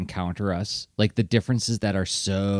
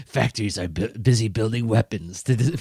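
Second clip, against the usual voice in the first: scary narrator voice. Retold or imitated. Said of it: "with this scary voice".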